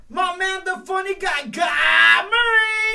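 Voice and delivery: loud drawn-out ring announcer. Acted. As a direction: stretch out the name like a ring announcer. Host announces guest, drawn out.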